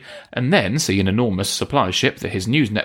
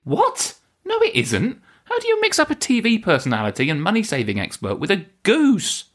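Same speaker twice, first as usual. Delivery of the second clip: incredulous; 12 semitones higher than usual. Real, not acted. Exasperated at absurd claim.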